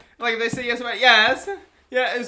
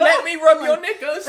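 mock British; 9 semitones higher, 10 dB louder than usual. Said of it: "you gotta think".

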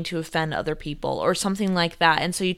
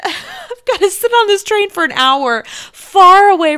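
laughing outburst at her own plight; laughing, louder and higher; 11 semitones higher, 8 dB louder than usual. Real, not acted. Stuck on a train for an hour, laughing loudly. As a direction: crack up mid-sentence.

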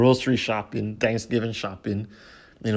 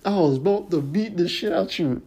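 mock fury; 9 semitones higher than usual. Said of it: "to Buckshot".

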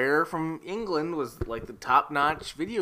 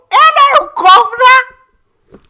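high, loud put-on Cockney cry; hammed-up Cockney accent, pitched way up; mock Cockney; 21 dB louder, faster than usual. Co-host jokes about a London beer name in hammed-up Cockney. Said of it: "That's the best you could do".

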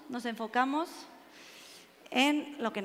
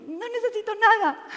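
higher-pitched, acted brush-off; insistent refusal; 7 semitones higher, 5 dB louder than usual. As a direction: wave off the offer of help, imitating an upset man. Weeping man refuses offered help. (negativa insistente, imitada)

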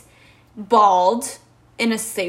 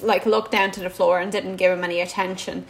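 ordinary talk, nothing marked

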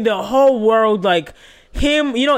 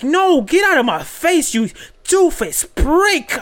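retold angry yelling; 7 semitones higher than usual. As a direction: imitate an irate telling-off, stretching the insult. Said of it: "Faye was yelling at Teddy".

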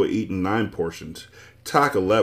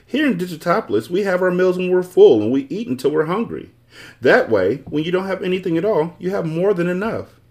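lightly voiced, higher know-it-all magician; math magician voice; 10 semitones higher, 4 dB louder than usual. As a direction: play the smug magician, pitched up.

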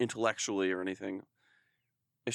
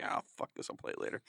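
muttered aside; 10 dB quieter, faster than usual. Retold or imitated. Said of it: "was like".

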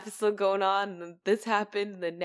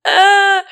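mock wail; 12 semitones higher, 15 dB louder than usual. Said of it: "it was like".

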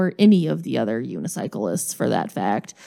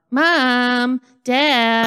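high, whiny little sister calling her parents; annoying little sister; 4 semitones higher than usual. Retold or imitated. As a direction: imitate a kid sister tattling to the parents. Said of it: "play the annoying little sister card".

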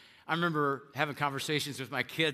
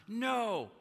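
protesting; 5 semitones higher, 5 dB louder than usual. Acted. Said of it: "Peter responds".

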